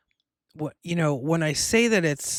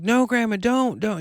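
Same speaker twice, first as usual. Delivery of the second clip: mild imitated yell, higher pitched; retold yelling plea; 6 semitones higher, 3 dB louder than usual. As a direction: plead urgently as if calling her back, held short of a shout. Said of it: "I'm yelling for her not to leave me".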